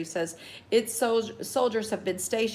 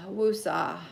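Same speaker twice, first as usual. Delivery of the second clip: slow falling calm-down breath word; real self-soothing woosah; calming mantra. Real, not acted. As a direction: talk yourself down from anger. Calming herself after rant.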